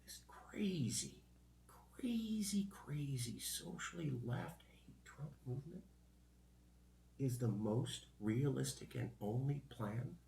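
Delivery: hushed, dramatic news-anchor whisper; newscaster voice. Pretend. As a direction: whisper it like a breaking news teaser. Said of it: "my newscaster voice".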